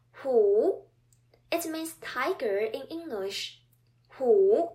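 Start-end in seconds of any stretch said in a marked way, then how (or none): 0.1-0.8 "hu" loud, dipping then rising; third tone. Shown as a demonstration. Teacher giving the word for tiger, loud dipping and rising third tone. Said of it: "word with the third tone is". 4.2-4.7 "hu" loud, dipping then rising; third tone. Shown as a demonstration. Teacher giving the word for tiger, loud dipping and rising third tone. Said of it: "word with the third tone is".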